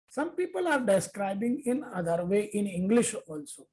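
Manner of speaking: normal talking voice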